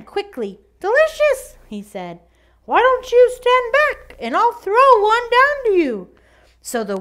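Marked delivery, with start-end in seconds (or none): 0.8-1.6 little pig voice. Acted. Pig answers wolf from tree. 2.6-6.1 much higher, louder piglet voice; a bright little pig, playing clever. Pig voice in story.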